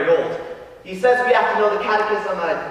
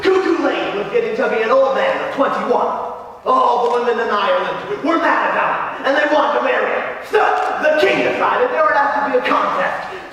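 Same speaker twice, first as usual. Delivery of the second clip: higher-pitched, eager tall-tale telling; excited boy storyteller; 5 semitones higher than usual. Acted. Mikey tells Frankie the rude legend, excited and eager. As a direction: relish the naughty legend, building with glee.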